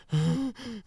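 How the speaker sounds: high, wheezy mock gasps; exaggerated winded gasp; imitated exhausted gasping. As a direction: act dead from a workout, gasping. Imitates wrestler winded after workout.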